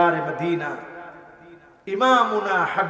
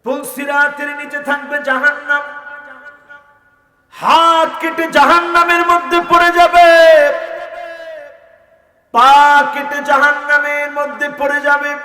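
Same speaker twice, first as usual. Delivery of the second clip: high, sustained sermon chant; impassioned chanting preacher; melodic preaching; 10 semitones higher, 11 dB louder than usual. Real, not acted. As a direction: intone each phrase high and let it ring.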